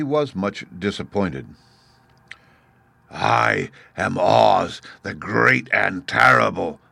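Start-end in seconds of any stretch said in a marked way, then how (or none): 3.1-6.8 slow, booming beast voice; a hint of a roar, drawn out. Beast Oz announcing itself to the Woodman, slow and booming. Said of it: "in a voice that was one great roar".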